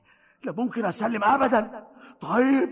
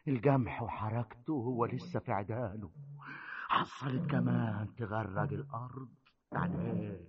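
brooding to himself; 7 semitones lower, 7 dB quieter than usual. Acted. Brooding alone over ruined land.

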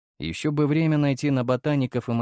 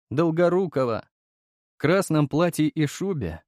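ordinary delivery, nothing marked

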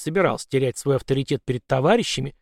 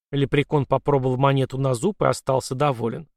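ordinary delivery, nothing marked

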